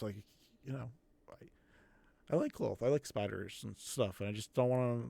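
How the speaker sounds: put-on soft, halting kid, unsure of himself; bullied spider-loving kid. Bullied kid explaining himself to his rescuer, soft and hesitant. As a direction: quietly fumble for the words, with false starts.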